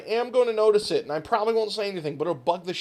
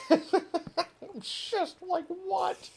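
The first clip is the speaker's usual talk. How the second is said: laughing what; 11 semitones higher, much slower than usual. Real, not acted. Bewildered laughing at viewer reaction.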